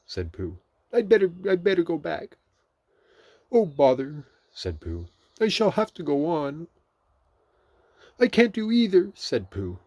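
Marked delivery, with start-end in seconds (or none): 0.9-2.4 high, loud bear voice; flustered Pooh. A narrator's reading. Pooh stuck halfway out, flustered. 3.5-4.3 high, loud exclamation; vexed Pooh. A narrator's reading. Pooh unable to go back, vexed. 5.4-9.1 high, loud bear voice; stuck Pooh's dismay. A narrator's reading. Pooh unable to move either way, dismayed.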